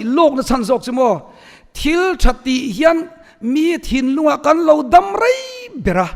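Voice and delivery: high, loud, insistent preaching; forceful preaching. Real, not acted.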